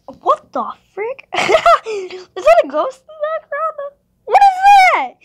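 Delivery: high, loud startled shout; startled yelp at a ghost. Real, not acted. Spots ghost in background.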